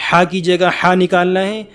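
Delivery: normal talking voice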